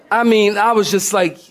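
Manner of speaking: ordinary talk, nothing marked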